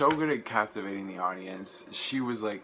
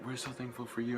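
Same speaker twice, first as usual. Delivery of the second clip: soft, higher-pitched quote of the singer; quoted singer; 4 semitones higher, 7 dB quieter than usual. Retold or imitated. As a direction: say it softly, as the singer to her crowd. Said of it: "'cause she's like".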